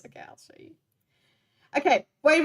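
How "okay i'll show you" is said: breathy unvoiced aside to chat; whisper. Real, not acted. Streamer to chat, whispered aside. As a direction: whisper it like a secret to viewers.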